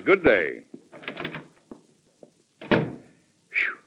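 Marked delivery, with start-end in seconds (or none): 2.7-3.8 a soft unvoiced puff of relief; relieved phew. President alone after the senator leaves, a soft unvoiced phew of relief. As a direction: blow out the tension once the door shuts.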